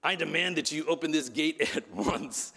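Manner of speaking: pompous sergeant's mock demand; cartoonish officer pulling rank; Joseph R. Taylor. Preacher mimics bandmate confronting gate guard, pompous demanding voice. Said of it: "He walks up and he says".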